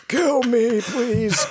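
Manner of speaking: high, strained plea to be killed; pleading monster voice. Retold or imitated. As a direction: beg to be put out of misery. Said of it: "They were like".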